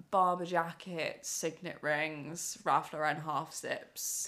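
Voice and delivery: slow, savouring each item; slow list. Real, not acted. Listing posh items slowly.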